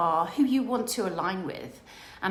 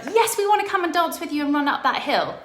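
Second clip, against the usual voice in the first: loud, keen acceptance; imitating keen followers; eager yes; 7 semitones higher, 7 dB louder than usual. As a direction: jump at the chance.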